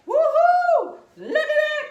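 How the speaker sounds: high, loud whoop with laughter; excited whoop. Real, not acted. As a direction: cheer at the pile of squash, laughing. Showing off harvested squash.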